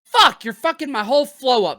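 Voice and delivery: high, loud burst of frustration; exasperated outburst. Real, not acted. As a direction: protest at being thrown off your flow. Host interrupted mid-flow by co-host.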